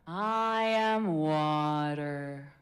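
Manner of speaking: very slow, calm meditative voice; water voice. Shown as a demonstration. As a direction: let it flow slowly and evenly.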